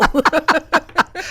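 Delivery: big delighted laugh; loud burst of laughter. Real, not acted. Shared laugh at bad date.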